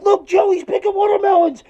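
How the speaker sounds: very high-pitched squealed punchline; hammed-up hysterics, pitched sky-high; hysterical laughing. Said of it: "laughing hysterically".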